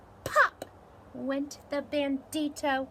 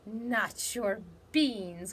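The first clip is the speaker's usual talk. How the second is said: outraged cry; much slower than usual. Acted. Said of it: "cried Scapito, outraged".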